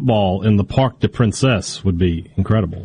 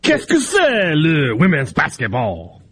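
put-on French accent; 5 semitones higher, much slower than usual. Mock French reaction.

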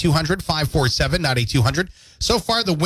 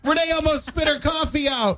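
cracking up while repeating it; giggling through the words, half crying with laughter; laughing; 13 semitones higher than usual. Real, not acted. Laughing about Renee's coffee to co-host.

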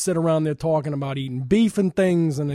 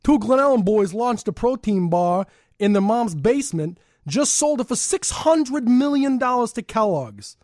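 put-on slow, higher-pitched Chicago accent; Chicago South Side accent; 6 semitones higher, slower than usual. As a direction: read local news as a South Side Chicagoan. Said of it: "All right, Chicago accent".

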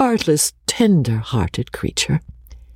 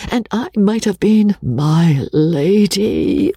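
woeful repentance; 7 semitones higher than usual. A narrator's reading. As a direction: wail with regret over lost riches. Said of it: "in the woes of repentance".

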